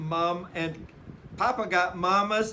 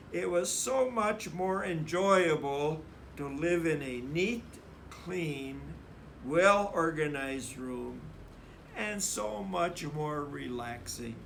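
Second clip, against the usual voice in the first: usual speaking voice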